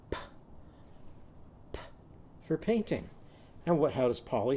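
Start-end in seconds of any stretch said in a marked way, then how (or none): 0.1-1.8 "P" as a soft voiceless pop, no vowel; "P" as a quiet phonics sound, not the letter name; isolated letter sound. Shown as a demonstration. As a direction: puff out just the last sound of top for "P". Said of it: "Show me the last sound you hear".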